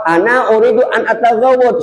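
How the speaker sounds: imitating Arabic speakers. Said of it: "Nah, kalau".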